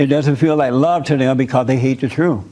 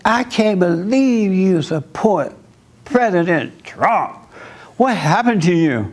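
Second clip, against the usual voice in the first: slow, higher mock disbelief; mimicking cousin; 3 semitones higher, slower than usual. To the listeners, imitating his Democrat cousin, slow mock disbelief. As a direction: drag it out like an incredulous cousin. Cousin quoted mocking on phone.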